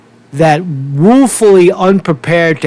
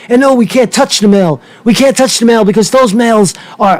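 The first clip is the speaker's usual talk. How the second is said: higher, whiny mock-frightened voice; mocking fearful official; 6 semitones higher than usual. Host mocks election officials' corona excuse in a higher whiny voice. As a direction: ham up a nervous official warning about the ick.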